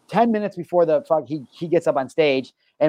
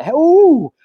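sudden high, loud excited greeting; light up at the new haircut; jumping up high and loud on seeing him; excited ooh; 12 semitones higher, 6 dB louder than usual. Real, not acted. Spotting Rich's new haircut.

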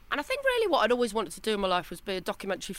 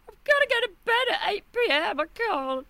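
whiny party voice; 12 semitones higher than usual. Retold or imitated. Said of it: "I go".

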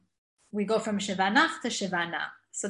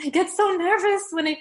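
nervous; 8 semitones higher, 5 dB louder than usual. Retold or imitated. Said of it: "I said".

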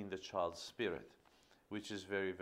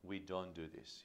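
quiet, firm parent voice; softer, steady firm correction; firm but soft; 3 semitones lower, 7 dB quieter than usual. Shown as a demonstration. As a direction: set the rule calmly and softly. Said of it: "but in a firm way".

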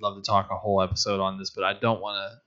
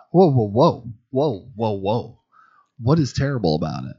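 hammed-up "whoa, whoa", louder at first, then settling; mock protest; 4 dB louder than usual. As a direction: stop her mid-complaint, half-outraged. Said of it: "I'm like".

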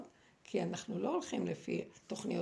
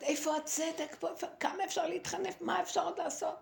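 high, pleading mock shout at moderate volume; imitated cry of desperation, pitched well up; retold outcry; 8 semitones higher than usual. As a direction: re-enact crying out to heaven in protest. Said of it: "ואני צועקת".

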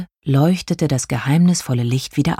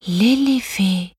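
high, drawn-out surprised call; little star's mild astonishment; amazed; 6 semitones higher, slower than usual. A narrator's reading. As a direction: call the name slowly in wonder. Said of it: "rief Liam verwundert".

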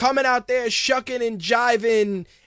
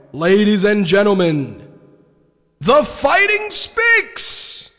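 mock arena announcer; 6 dB louder, slower than usual. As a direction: stretch the name like a big arena reveal. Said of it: "the sound effect that lets me do the arena".

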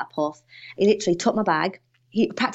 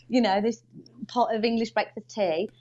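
usual speaking voice

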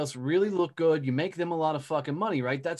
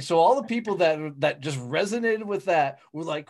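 normal talking voice

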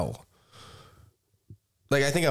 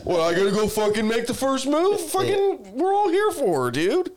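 high-pitched comic indignation, climbing higher; mock exasperated rant; 13 semitones higher than usual. Contestant grumbling about making the first move, high mock indignation. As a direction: complain in a hammed-up high voice.

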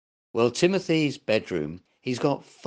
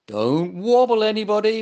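louder, higher warning call; 8 semitones higher, 6 dB louder than usual. Acted. Bear warning friends on the raft, loud and higher. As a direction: warn everyone to keep still, raised voice. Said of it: "warned Gumper".

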